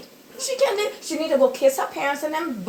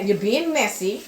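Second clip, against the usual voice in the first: ordinary talk, nothing marked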